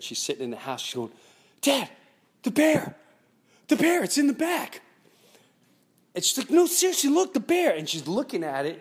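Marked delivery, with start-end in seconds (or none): 1.6-4.8 high, loud imitation of daughter; daughter's serious alarm. Daughter alerts Dad to a real bear, high alarmed call. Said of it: "her face was just different". 6.5-7.7 high, loud imitation of insistent daughter; daughter, serious. Daughter convinces Dad the bear is real, urgent insistence. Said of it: "she's being pretty serious".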